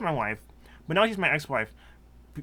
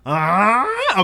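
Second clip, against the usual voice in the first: surprised; 6 semitones higher, 11 dB louder than usual. Real, not acted. Exaggerated questioning.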